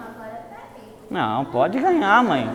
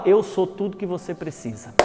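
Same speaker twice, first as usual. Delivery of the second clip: softer, calm reassurance; gentle promise; 3 semitones lower, 9 dB quieter than usual. Retold or imitated. Jesus tells the desperate he is all they need, softly. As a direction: offer it quietly, like a rescue. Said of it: "Jesus chega e fala".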